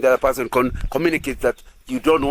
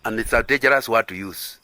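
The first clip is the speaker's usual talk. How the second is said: ordinary talk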